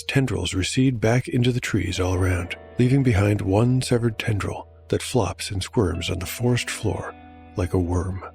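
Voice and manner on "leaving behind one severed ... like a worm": usual speaking voice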